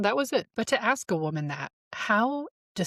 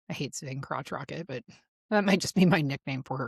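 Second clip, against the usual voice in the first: ordinary talk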